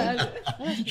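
high, mocking burst of laughter; a short disbelieving laugh; scornful laugh. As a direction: laugh at how naive they are. Host laughs off the question.

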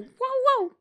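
high bark said as words; high dog bark wow. Shown as a demonstration.

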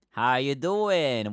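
very slow, stretched how you doing; a lazy, stretched hello; drawn-out greeting. Real, not acted. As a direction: draw out each word warmly.

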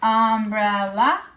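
"umbrella" in three slow beats, rising at the end; split into syllables. Shown as a demonstration. Teacher recaps three-syllable words, "umbrella" split and rising. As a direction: list "umbrella" piece by piece, ending upward. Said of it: "words with three syllables".

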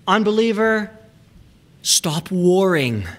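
Unbeliever called out high, stop warring slow and firm; slow it down and command stop warring; stern command. Shown as a demonstration. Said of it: "I would translate it this way".

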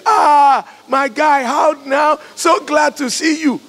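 loud, high-pitched, exaggerated delight; hammed-up shout of joy at greeting a visitor; gushing fake welcome.

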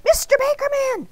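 ordinary delivery

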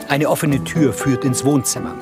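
ordinary delivery

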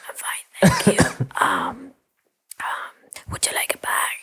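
whispered, rote cashier politeness; bored mechanical politeness. Retold or imitated. Cashier repeated, then asks about bag.

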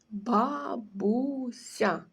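Бабуся drawn out very slowly, syllable by syllable; teacher spelling out Бабуся syllable by syllable, very slowly; slow syllable demo. Shown as a demonstration. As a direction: stretch out Бабуся so the children count each syllable. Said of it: "поклали її під підборіддя".

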